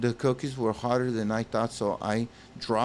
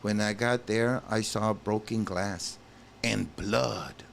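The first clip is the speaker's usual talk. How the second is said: usual speaking voice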